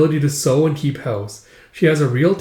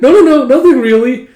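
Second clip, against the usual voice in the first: flustered denial; 10 semitones higher, 9 dB louder than usual. Acted. Flustered denial while caught stalking.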